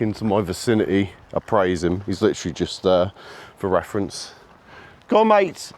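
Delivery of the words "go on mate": high call that cracks mid-word; voice breaking. Real, not acted. Owner calling his dog, voice breaking on the high call. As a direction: let the voice crack as it jumps up. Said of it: "My voice keeps breaking of late".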